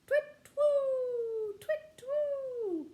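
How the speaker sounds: very high, loud hooting screech; owl screech. Acted. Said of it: "The barn owl screeched again".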